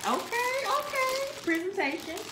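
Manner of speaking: high-pitched, thrilled okays; excited high voice. Real, not acted. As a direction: squeak out the excitement. Seeing the bag packaging.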